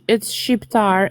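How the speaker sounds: Shqiptar said slowly, shq like sh, shtar ending; host corrects the caller, slowly spells out Shqiptar. Shown as a demonstration. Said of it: "it literally means Albanian".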